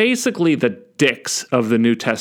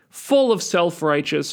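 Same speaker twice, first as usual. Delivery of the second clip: usual speaking voice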